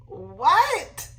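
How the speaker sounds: normal talking voice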